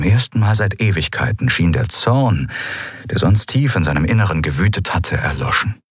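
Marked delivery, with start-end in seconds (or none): none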